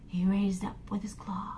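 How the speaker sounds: suspenseful whisper. A narrator's reading. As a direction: whisper the threat.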